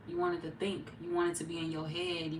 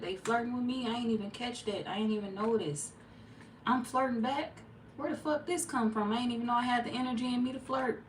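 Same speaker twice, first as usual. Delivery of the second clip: higher-pitched, mock disbelief; incredulous self-talk; 7 semitones higher than usual. Talking to yourself about unexpected flirting, high incredulous voice. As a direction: act out being caught off guard, pitch lifted. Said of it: "you just saying to yourself".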